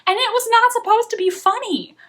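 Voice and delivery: exasperated. Real, not acted. Exasperated emphasis.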